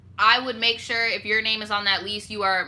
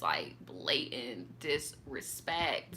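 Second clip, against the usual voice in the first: slow, soft whisper; whispered disgust; 9 semitones lower, 12 dB quieter, much slower than usual. Real, not acted. Telling viewers about a cheater, in a slow whisper. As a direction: breathe it out in disbelief.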